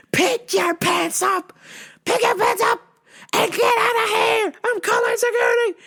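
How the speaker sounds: high, frantic shouted orders; boss's mock yelling. Boss ordering a customer out, mock yelling. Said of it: "My mans was yelling".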